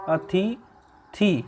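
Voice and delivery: अतिथि stretched very slowly, each syllable clear; drawn-out model word. Shown as a demonstration. Teacher modelling the correct word for students, very slow and drawn out. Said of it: "इसमें था में छोटी की मात्रा आती है".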